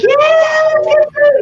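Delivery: very high, loud mock cheer; eager crowd. Everyone crashing the couple's dinner date, overexcited. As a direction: imitate a whole group excitedly inviting itself along. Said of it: "everyone would be like".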